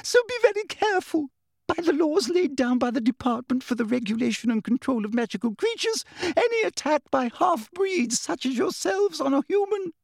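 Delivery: high, officious warning through fear; frightened bureaucrat, pitched very high. A narrator's reading. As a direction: warn them in a squeaky official voice. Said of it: "in an even higher voice".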